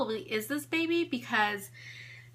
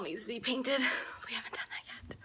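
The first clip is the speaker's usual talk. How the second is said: breathy whisper, just for the camera; whispered aside; 7 dB quieter, faster than usual. Real, not acted. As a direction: let it drop into a secret whisper. Aside about unpainted wall.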